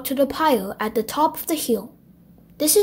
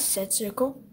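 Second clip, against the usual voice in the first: ordinary delivery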